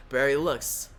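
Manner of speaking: ordinary delivery, nothing marked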